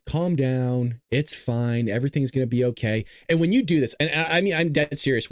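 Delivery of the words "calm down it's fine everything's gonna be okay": low, unhurried soothing voice; calm reassurance. Retold or imitated. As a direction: talk them down gently. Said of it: "That's exactly how I react".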